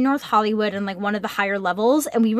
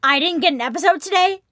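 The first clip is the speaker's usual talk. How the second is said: higher-pitched mock indignation; put-on complaint in a raised pitch; mock outraged listener; 6 semitones higher than usual. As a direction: play an affronted listener, pitched up. Acts out an annoyed listener.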